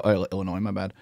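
usual speaking voice

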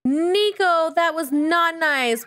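high-pitched yelp easing into a telling-off; shocked scolding. Pretend. Player yelling at Nico for being mean, shocked scolding. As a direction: cry out high, then stretch out the reprimand. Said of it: "We're yelling at you for being mean".

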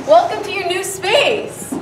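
slow, high, loud grand announcement; excited reveal. Acted. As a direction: unveil it like a game show host.

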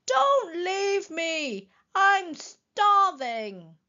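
high, very drawn-out wail; pleading call. A narrator's reading. Wilbur begs the departing elephants, pleading call. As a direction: wail it slowly after them. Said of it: "called Wilbur".

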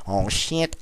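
ordinary delivery

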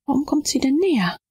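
ordinary delivery, nothing marked

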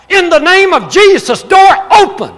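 high, loud commanding cry. Retold or imitated. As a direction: call out in alarm to open the stuck door. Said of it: "Shar cried out".

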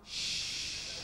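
a soft, unvoiced shush; quiet so mum stays asleep; hushing whisper. Creeping past a sleeping mum, whispered shush.

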